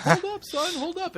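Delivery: very high, comic plea to wait; goofy dad calling. Retold or imitated. Bumbling dad chasing his son through Russia, very high comic call. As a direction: call after him like a bumbling sitcom dad. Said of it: "picture Kevin James".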